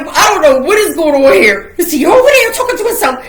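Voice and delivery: high, loud, fast incredulous question with laughter; rushed giggly bewilderment; laughing disbelief. Retold or imitated. Retelling confused worry, laughing.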